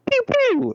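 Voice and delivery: high squeaky "pew pew" gun noise; gunshot sounds. Retold or imitated. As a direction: zap it out like a toy blaster. Mimicking gunshots in a story.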